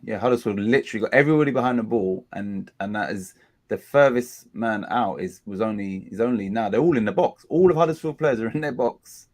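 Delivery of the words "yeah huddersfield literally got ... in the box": normal talking voice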